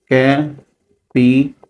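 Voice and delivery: Capital slowly split as ক্যা, পি, টাল; syllable by syllable. Shown as a demonstration. Teacher showing three syllables in capital, separating each one. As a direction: break capital into three syllables with clear pauses. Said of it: "তাহলে এইটা একটা syllable".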